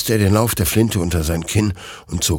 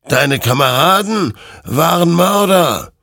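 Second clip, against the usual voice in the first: damning accusation; 11 semitones higher, 6 dB louder than usual. Acted. Creature condemns Hector's comrades, loud and accusing. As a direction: proclaim the guilt forcefully.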